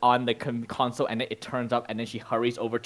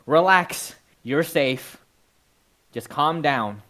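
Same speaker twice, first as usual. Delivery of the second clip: firm reassurance; 3 semitones higher, 6 dB louder, slower than usual. Acted. Game master voices someone steadying the player.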